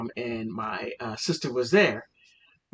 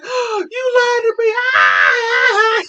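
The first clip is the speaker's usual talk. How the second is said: very high, loud, half-crying squeal; imitated laughing shriek; 9 dB louder, much slower than usual. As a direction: shriek it like a friend in hysterics.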